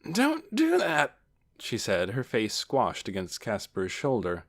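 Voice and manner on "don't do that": high, slow protest; protesting aunt. A narrator's reading. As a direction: scold a nephew from inside his hug. Aunt objects, squashed in a hug.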